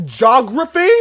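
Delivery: "Geography" loud and high, as "jog-ruffy"; incredulous rising shout of "Geography"; mocking pronunciation. Mocking the British guest's word, "Geography" yelled as "jog-ruffy".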